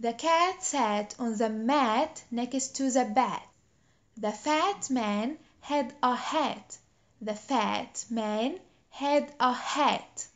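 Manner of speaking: very slow, each word spaced out; over-articulate "The cat sat on the mat" slowly; slow model sentence. Shown as a demonstration. Teacher reads a practice sentence slowly for repeating. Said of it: "Try that".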